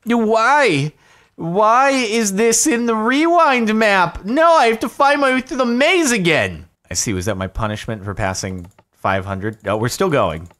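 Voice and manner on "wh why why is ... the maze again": high, loud wail of dismay; loud, drawn-out exasperated whine; anguished protest. Real, not acted. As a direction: cry out at being sent back. Dismay at being sent back.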